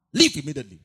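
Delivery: loud firm command; stern order. Retold or imitated. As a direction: order her out at once. Pastor orders girl to quit.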